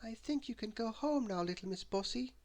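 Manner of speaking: light high-pitched character; small character voice. A narrator's reading. Miss Neat dismissing Bossy.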